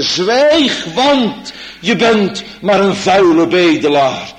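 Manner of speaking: loud, high scolding hush; harsh rebuke. Retold or imitated. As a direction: shut him up sharply. Omstanders snauwen bedelaar af. (luid en scherp berispend)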